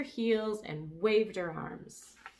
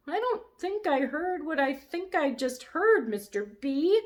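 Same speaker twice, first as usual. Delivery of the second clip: much higher, squeaky and astonished; squeaky amazement; 9 semitones higher, 6 dB louder than usual. A narrator's reading. Lulu stunned by the brontosaurus, squeaky amazed. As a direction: squeak out your disbelief. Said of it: "in a squeaky, amazed kind of voice".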